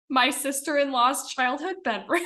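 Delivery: giggling through the words, pitch jumping up; laughing. Real, not acted. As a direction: laugh it out, amused at the memory. Laughing at her makeshift office.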